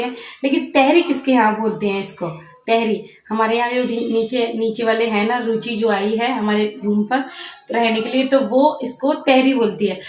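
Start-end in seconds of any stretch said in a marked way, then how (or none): none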